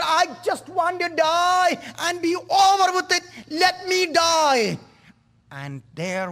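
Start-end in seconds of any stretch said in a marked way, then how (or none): 0.0-4.8 high, anguished plea, slowing at the end; a tormented soul pleading to die, high and desperate; begging to die. Acted. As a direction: beg for release from endless torment. Condemned man begging to die.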